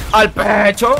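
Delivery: loud punchy "Al pecho"; shouted catchphrase. Real, not acted. Gamer yelling his catchphrase mid-fight. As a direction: bark it out at the rival.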